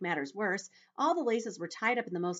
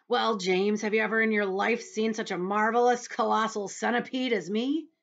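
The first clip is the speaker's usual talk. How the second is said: loud, higher, grand bragging voice; boastful centipede; 5 semitones higher, 7 dB louder than usual. Acted. Centipede showing himself off to James, loud and boastful. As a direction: brag it out loudly.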